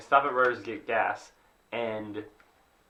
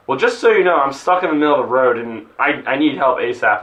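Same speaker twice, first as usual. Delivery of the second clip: retold demand; 8 dB louder than usual. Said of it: "I'm like".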